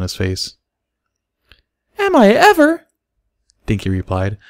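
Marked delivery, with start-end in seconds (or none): 2.0-2.8 put-on very high, loud little girl voice; high thrilled childlike reply; eager filly. A narrator's reading. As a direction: bounce on the words. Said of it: "bouncing up and down in her chair".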